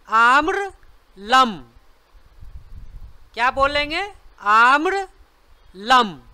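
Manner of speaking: आम्रलम drawn out slowly, each syllable held; slow answer word. Shown as a demonstration. Teacher slowly answers the quiz word आम्रलम for the class. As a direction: stretch आम्रलम out for learners to repeat. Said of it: "क्या बोलते हैं".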